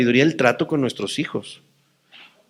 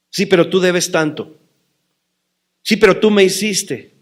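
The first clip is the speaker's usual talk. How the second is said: quarrelsome retort; 6 semitones higher, 5 dB louder than usual. Retold or imitated. Voicing quarrelsome complaint.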